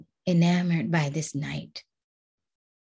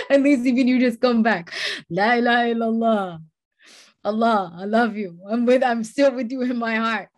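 higher and louder, full of feeling; fervent; 5 semitones higher, 5 dB louder than usual. Real, not acted. Preacher declaring love to Allah, fervent and raised. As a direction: pour out love for God.

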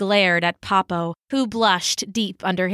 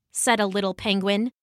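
ordinary talk

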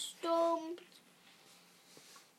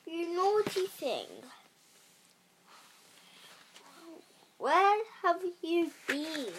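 higher, scolding king; thundering king; 4 semitones higher than usual. A narrator's reading. King scolding his niece Pearl, mild thundering. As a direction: scold her like an angry uncle. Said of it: "he thundered".

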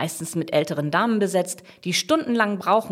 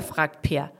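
plain reading voice

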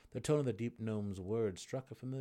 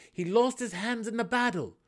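loud insistent cry, high; stubborn; 11 dB louder than usual. A narrator's reading. Drizzt insists to the gnome, loud and stubborn. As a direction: push on over him, loud. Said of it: "Drizzt went on stubbornly".

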